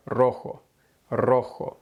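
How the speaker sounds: rojo with a trilled initial r; rolled r. Shown as a demonstration. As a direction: roll the r at the start of rojo. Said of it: "words that start with the rolled R sound".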